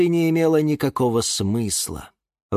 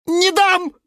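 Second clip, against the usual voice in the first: high, loud shriek; 5 dB louder than usual. Acted. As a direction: squeal the refusal. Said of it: "взвизгнул Юра". (визг, высоко и громко)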